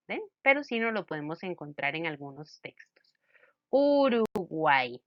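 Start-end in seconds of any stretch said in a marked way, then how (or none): 3.7-5.0 "Uruguay" slowly, its last letter sounded as I; final Y as I. Shown as a demonstration. Teacher showing a triphthong to students, "Uruguay" stretched to a final I. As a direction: draw out "Uruguay", ending in an I sound. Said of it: "al final suena como una I".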